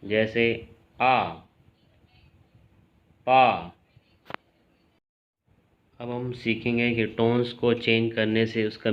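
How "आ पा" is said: पा in Mandarin falling tone; falling fourth tone. Shown as a demonstration. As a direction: snap पा down from the top. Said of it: "आवाज़ ऊपर से नीचे की तरफ जाती है".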